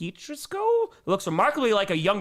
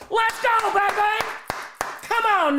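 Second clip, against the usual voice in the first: excited cheer; much slower than usual. Real, not acted. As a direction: streamer cheering a viewer's first grandson, excited shout. Celebrating a viewer's new grandson.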